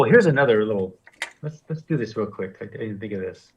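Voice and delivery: ordinary talk, nothing marked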